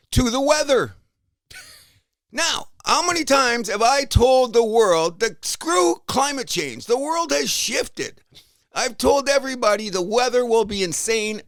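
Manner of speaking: high, slow and loud proclamation; loud slow rant. Real, not acted. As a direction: declare it to the whole world. Shout-out rant at the weather.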